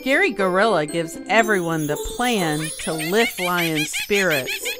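plain reading voice

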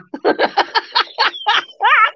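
long high giggle; laughing. Real, not acted. Tickled by her own word for a trump, high giggling. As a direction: dissolve into high-pitched giggles.

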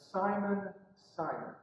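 name said softly, dropping away; soft, falling "Simon, Simon"; gentle address. Retold or imitated. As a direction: call him quietly by name. Jesus calls Peter by name.

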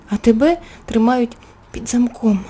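plain reading voice

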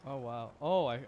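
normal talking voice

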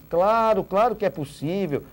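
ordinary delivery

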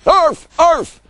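two high, loud yaps; small dog yapping. A narrator's reading. Tiny backing up Brute, yapping. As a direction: yap like a little dog. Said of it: "added Tiny".

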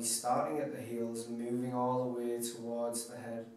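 hushed, unhurried guide into deep relaxation; guided meditation. Acted. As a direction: talk them softly through a body scan, letting each phrase settle. Leads relaxation body scan.

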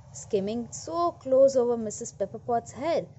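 usual speaking voice

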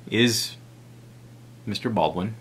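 ordinary talk

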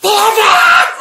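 yelling at the top of her lungs, high; a full, high yell; screamed. Retold or imitated. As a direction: scream the word address at him. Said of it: "I scream at him".

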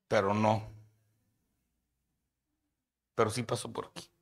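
ordinary talk

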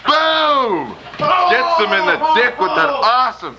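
high-pitched exclaimed hit call; excited play-by-play. Real, not acted. Commentator calling a kendo stick shot, excited play-by-play.

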